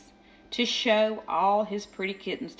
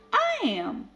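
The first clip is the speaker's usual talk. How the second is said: high, squabbling cat voices; each kitten shrilly claims to be prettiest; bickering chorus of tiny cats; quarrelling cats; 3 dB louder than usual. A narrator's reading. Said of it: "cried the hundreds and thousands".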